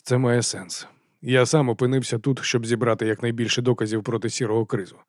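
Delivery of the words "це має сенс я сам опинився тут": ordinary delivery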